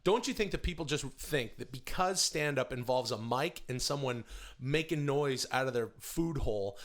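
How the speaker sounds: usual speaking voice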